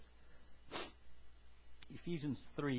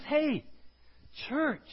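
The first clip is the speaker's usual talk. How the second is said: Paul calling out to the church, loud and slow; loud impassioned plea; 11 semitones higher, 11 dB louder, slower than usual. Acted. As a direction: plead with the church at full voice. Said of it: "So he's saying this".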